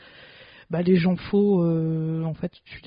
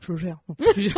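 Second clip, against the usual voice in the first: normal talking voice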